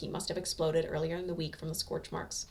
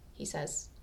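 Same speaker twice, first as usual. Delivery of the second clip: usual speaking voice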